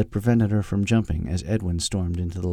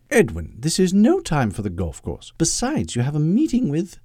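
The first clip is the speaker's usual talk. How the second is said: higher-pitched British secretary reproving her boss; prim English secretary, scolding; 7 semitones higher than usual. Acted. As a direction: pull him up primly, pitch climbing. Voices Agnes in the story.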